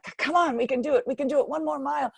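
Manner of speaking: retold frantic encouragement; urging on. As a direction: drag him the last mile with your voice. Dragging collapsing coach to finish.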